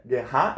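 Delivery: "guerra" mis-stressed as gue-RRÁ; stress on last syllable. Shown as a demonstration. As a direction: throw the weight onto the end of "guerra". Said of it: "teria acento".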